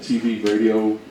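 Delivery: ordinary delivery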